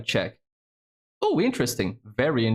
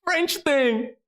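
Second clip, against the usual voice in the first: much higher, delighted mock squeal; excited squeal; much slower than usual. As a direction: squeal like she just heard her favourite topic. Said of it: "she's like".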